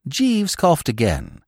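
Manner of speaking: ordinary delivery, nothing marked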